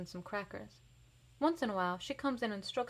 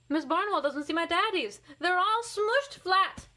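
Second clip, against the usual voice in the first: rising alarm; 10 semitones higher, 7 dB louder than usual. A narrator's reading. Nightmare discovery.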